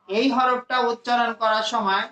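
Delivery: usual speaking voice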